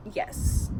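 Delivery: a squeaky breathless high yes; deadpan retold yes. Answering people who doubt her accent with a high mock-innocent yes. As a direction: toss off the yes as if the question were silly. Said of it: "And I'm like".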